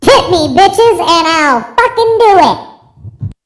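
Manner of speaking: high, loud and slow hype close; loud pitch. Acted. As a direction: bellow the ad's finish. Loud end to a mock ad.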